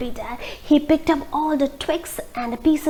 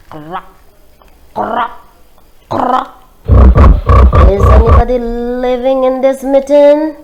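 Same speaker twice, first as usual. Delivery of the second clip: croaking, then a louder call; frog voice; 11 dB louder than usual. A narrator's reading. Frog calls at the mitten.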